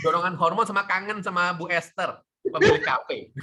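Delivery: high, loud playful tease; giggly teasing; teasing laugh. Real, not acted. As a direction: rib a friend about her cafe crush. Teasing about cafe owner.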